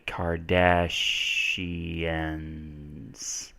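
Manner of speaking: "Kardashians" stretched very slowly, syllable by syllable; sounding out slowly. Shown as a demonstration. Teacher spelling for viewers, slowly sounding out "Kardashians". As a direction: draw out "Kardashians" softly as if writing it.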